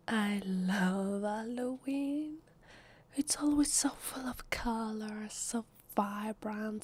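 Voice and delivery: slow, drawn-out delight; savouring gush. Acted. Halloween fan to party guest, slow savouring gush. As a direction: stretch each word out with relish.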